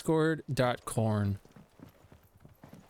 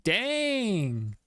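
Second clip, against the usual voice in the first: dang pitched high and stretched into a long fall; drawn-out high dang; 7 semitones higher than usual. Shown as a demonstration. Streamer reacting to the game, a drawn-out high dang. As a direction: sing out dang high then let it fall. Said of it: "Hella a voice".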